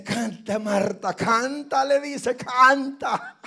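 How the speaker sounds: loud urging call to sing, with a laugh; urging shout. Retold or imitated. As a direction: push her to sing, half laughing. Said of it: "y le dice". (llamado fuerte y animado entre risas)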